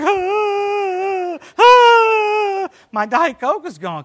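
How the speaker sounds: put-on high, loud comic baby cry over lost soda; hammed-up mock wail. Preacher retelling his exploded Diet Coke, mock sobbing wail. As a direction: wail it like a baby, played for laughs. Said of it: "I cried like a baby".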